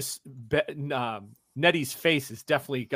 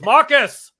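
sharp shouted call of a name; 10 semitones higher, 10 dB louder than usual. Acted. Pastor calling out to Marcus, shouted. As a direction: yell his name across the crowd. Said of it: "and he goes".

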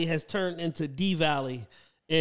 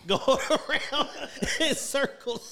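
laughing; 8 semitones higher, slower than usual. Real, not acted. Laughing while telling.

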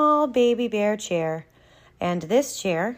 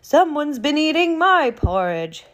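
higher, louder mother bear voice; raised, high-pitched storybook mama voice; medium-sized Mama Bear; 6 semitones higher, 3 dB louder than usual. Acted. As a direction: exclaim it as an indignant Mama Bear. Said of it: "in her medium-sized mama voice".

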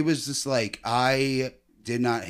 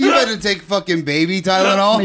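loud, high laughing disbelief; laughing shout; 8 semitones higher, 8 dB louder than usual. Real, not acted.

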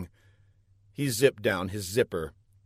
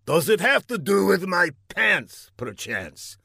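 testy villain, higher, then dropping; irritated villain; 7 semitones higher than usual. A narrator's reading. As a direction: demand it with icy irritation. Villain questions scientist.

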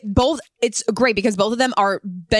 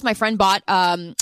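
normal talking voice

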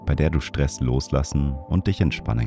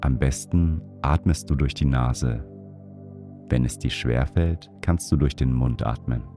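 guided meditation; 3 dB quieter than usual. Acted. Leads a breathing meditation.

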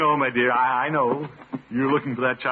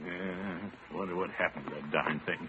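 much softer, low, to himself; muttering; 4 semitones lower, 11 dB quieter than usual. Acted. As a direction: grumble under the breath.

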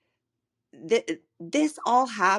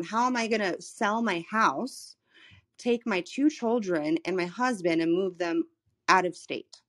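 ordinary delivery

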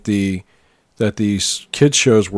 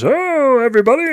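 much higher, goofy cartoon hero voice; high obnoxious voice. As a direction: squeal it like a dim cartoon Mountie. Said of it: "kinda this high, obnoxious voice".

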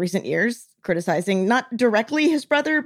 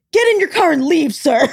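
high, raised-voice angry order; retold yelling; 5 semitones higher, 4 dB louder than usual. Prince throwing the general out, mock angry yell. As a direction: imitate an outraged prince ordering someone out. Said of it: "he keeps yelling".

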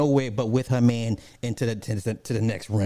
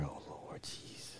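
breathless whisper under the breath; whispered aside; 22 dB quieter, slower than usual. Real, not acted. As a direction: mutter it almost to yourself. Aside after imagining dying.

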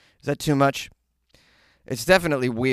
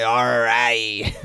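drawled pirate growl; mock pirate talk; pirate accent; 4 dB louder than usual. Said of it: "It's more of a pirate accent".

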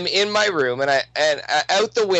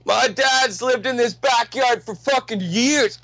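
higher-pitched imitation of a boasting guy; hammed-up retold taunt in a raised pitch; mock threatening neighbor; 6 semitones higher than usual. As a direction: put on the tent guy's boast, pitched up. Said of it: "and he starts going".

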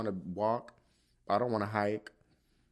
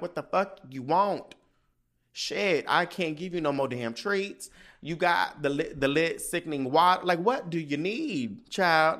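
exasperated scolding; 6 semitones higher, 4 dB louder than usual. Retold or imitated. Scolding whining dog.

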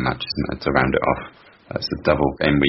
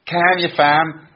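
a stab at a Liverpool Scouse accent; Scouse accent attempt; 11 semitones higher, 4 dB louder, much slower than usual. Shown as a demonstration. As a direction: imitate the Scouse accent, slightly high. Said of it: "have a stab at these three sentences".

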